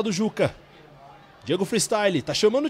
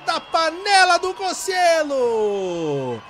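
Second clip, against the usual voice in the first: long drawn-out goal scream, high; explosive goal commentary; 9 semitones higher, 12 dB louder, much slower than usual. Real, not acted. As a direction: stretch every syllable of the goal call. Goal scored, long shout. (grito de gol prolongado)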